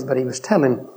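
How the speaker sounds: ordinary talk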